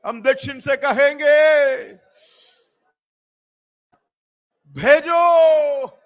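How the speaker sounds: drawn-out preacher's proclamation; fervent prayer declaration. Real, not acted. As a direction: call out commands to the four directions, stretching the endings. Prayer rally commands to the directions.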